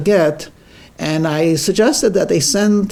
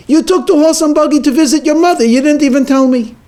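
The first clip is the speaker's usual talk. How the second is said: high, loud and fast, furious; enraged accusation; 10 semitones higher, 5 dB louder, faster than usual. Acted. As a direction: hurl the accusation at her, enraged. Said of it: "And he's very enraged".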